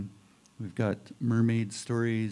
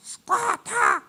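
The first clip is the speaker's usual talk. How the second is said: much higher, louder bird-like call of Squatta; creature calling its name; 5 dB louder than usual. Acted. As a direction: shriek the name out like the being itself. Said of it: "they all say their own name like that".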